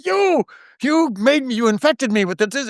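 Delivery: high playful mock blame; joking mock accusation. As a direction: toss it off as a joke. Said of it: "I'd turn it into a joke".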